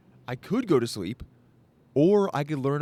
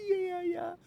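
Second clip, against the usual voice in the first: much higher, squeaky mock voice; giggly Viking. Cuddling Vikings in the sleeping bag, squeaky and giggly. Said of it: "were just like".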